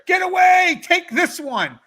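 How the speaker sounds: high, loud imitated sleep-yell; mother's nightmare scream. Son imitating mom bargaining in nightmare, screaming. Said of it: "letting your mom scream".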